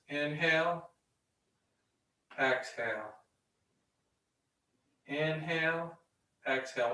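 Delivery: quiet, measured yoga breathing count; soft breath cue. Real, not acted.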